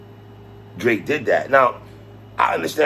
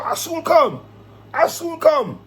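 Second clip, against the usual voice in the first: higher-pitched mock Jamaican accent; 10 semitones higher, slower than usual. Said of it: "In my Jamaican voice".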